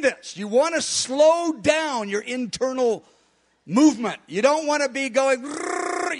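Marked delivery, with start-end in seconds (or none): 4.3-6.2 a quick revved-up growl for hurrying; mock rushing noise. Shown as a demonstration. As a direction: imitate racing inside with a rolled rrr. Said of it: "You want to go the opposite way".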